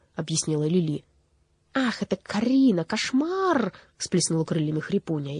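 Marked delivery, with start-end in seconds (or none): 1.7-3.7 high, dramatic dismay; hammed-up horrified exclamation; theatrical horror. A narrator's reading. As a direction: gasp it out like a scandalised crow. Crow horrified at sorceress's name.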